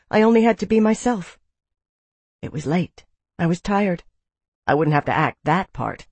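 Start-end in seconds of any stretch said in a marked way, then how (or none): none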